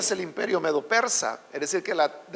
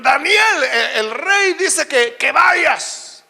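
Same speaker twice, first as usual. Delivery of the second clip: loud, high call with drawn-out words; messenger's summons; 4 semitones higher, 5 dB louder, much slower than usual. Acted. Messengers passing the king's order to Daniel, calling out. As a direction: call it out to an old man far away.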